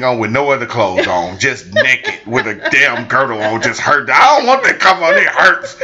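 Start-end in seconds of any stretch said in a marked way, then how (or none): 4.1-5.7 louder whiny mock complaint; imitating pained wife. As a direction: whine it like she's hurting.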